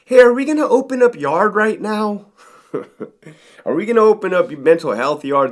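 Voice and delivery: a hint of an eager doctor nagging; mock pushy doctor. Mental health doctor pestering the lieutenant, in a mock high voice. Doctor pushing to reopen the yard.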